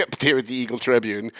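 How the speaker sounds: chuckling through a jab; laughing. Real, not acted. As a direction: laugh while naming the rival. Laughing jab at rival paper.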